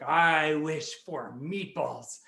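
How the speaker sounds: slow, drawn-out wish; stretched-out storybook wish; solemn magic wishing. Acted. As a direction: intone it slowly like an incantation. Neighbour makes a magic wish.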